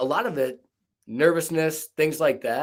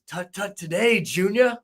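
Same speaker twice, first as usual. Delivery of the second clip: stuttered quote, high and slow; a light Billy Madison stutter; movie quote stutter; 7 semitones higher than usual. Retold or imitated. Quoting the Billy Madison classmate, high and slow. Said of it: "that's from Billy Madison".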